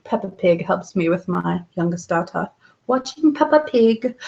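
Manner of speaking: amateur attempt at a British accent. Shown as a demonstration. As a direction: try out a British accent, playfully imperfect. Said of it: "bore you with my British accent".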